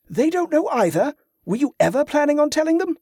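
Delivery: high, fast, angry accusing; angry accusation. A narrator's reading. Jordan accusing Carla of hiding the truth angrily. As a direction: hurl the accusation. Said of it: "still angry".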